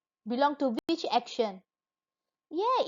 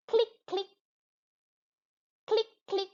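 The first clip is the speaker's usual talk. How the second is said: high, crisp click; teacher imitating a camera for the class; camera click imitation; 8 semitones higher than usual. Shown as a demonstration. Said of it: "It's we call".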